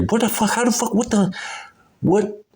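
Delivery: high, incredulous questions tumbling out; can't believe what he's seeing, pitch way up; stunned disbelief. Real, not acted. Gaping at a photo of giant rafts, high-pitched disbelief.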